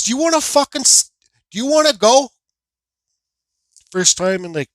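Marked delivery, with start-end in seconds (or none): 0.0-2.3 loud, high dare to brawl; retold fight challenge. Driver daring the other man to fight, retold fight challenge. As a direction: replay calling someone out.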